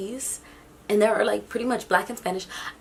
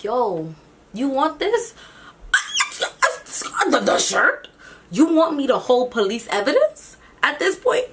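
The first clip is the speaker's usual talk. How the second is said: higher, louder, disbelieving cop; cop quoted, incredulous; 6 semitones higher, 4 dB louder than usual. Retold or imitated. Officer asking the narrator if she'll hold the evidence, higher and disbelieving. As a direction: play the officer mocking her offer. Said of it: "and he's like".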